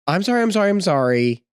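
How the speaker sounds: lightly mocking, high at first then settling; mimicked kid apology. Parent translating kids' apology, lightly mimicked whine. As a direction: parrot a child's hollow sorry.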